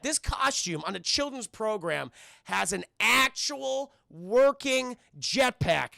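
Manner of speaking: ordinary delivery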